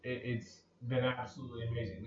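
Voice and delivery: ordinary delivery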